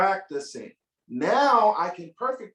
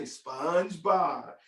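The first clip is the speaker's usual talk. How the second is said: drawn-out name; 5 semitones lower, much slower than usual. Shown as a demonstration. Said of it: "Turn to your neighbor and say".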